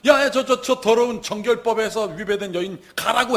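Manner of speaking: loud, high-pitched acted order; shouted dismissal. Synagogue ruler ordering the unclean woman out, shouted dismissal. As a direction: shout her away as an accuser.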